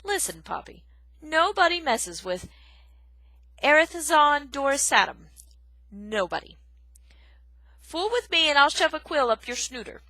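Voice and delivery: loud gruff threat; gruff porcupine boast. Acted. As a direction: boast with menace. Aerith boasts menacingly.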